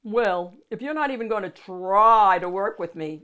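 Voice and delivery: loud, sulky monster whine; whining monster. A narrator's reading. Monster whines at the unimpressed child. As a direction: complain like a hurt monster. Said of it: "Mac whined".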